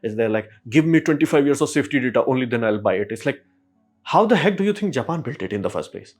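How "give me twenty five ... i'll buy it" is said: mock bureaucrat. Mocking railway officials' demand.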